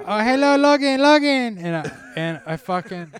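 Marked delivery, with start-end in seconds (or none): 0.1-1.6 high, loud mock mom greeting; ex's mom greeting. Imitating ex's mom greeting him in the store, high and loud. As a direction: imitate her calling out in a pitched-up voice. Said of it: "she's like".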